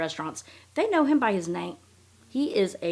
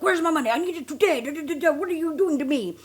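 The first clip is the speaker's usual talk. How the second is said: high, louder mock-irate demand; irate, imitated; 9 semitones higher, 3 dB louder, faster than usual. Swindler calling woman for his money, mock-irate. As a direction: imitate an outraged caller, pitched up. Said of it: "He's irate".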